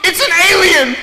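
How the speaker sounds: put-on high, loud child shouting to her mother; little girl yelling to mommy, high and loud; scared little girl. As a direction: squeal it like a frightened kid pointing. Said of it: "and she goes".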